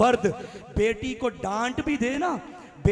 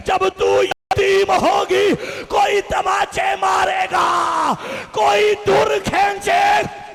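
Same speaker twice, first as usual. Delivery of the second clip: loud sung lament rising higher as it builds; wailing majlis lament; 4 semitones higher, 4 dB louder than usual. Acted. Preacher voicing Hussain's farewell to the mourners, wailing lament. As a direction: wail the father's words to the mourners in a chanting cry.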